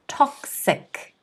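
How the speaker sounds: toxic, high TOX said softly; soft stressed TOXIC. Shown as a demonstration. Teacher softly modelling toxic with first-syllable stress. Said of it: "It is on the first syllable".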